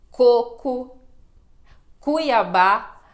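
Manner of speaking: coco and Cuiabá said loud with a hard K; K sound demo. Shown as a demonstration. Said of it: "O som do K". (som de K, bem marcado)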